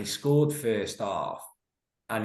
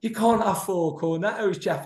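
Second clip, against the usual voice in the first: mock manager; 8 semitones higher than usual. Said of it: "And it's like".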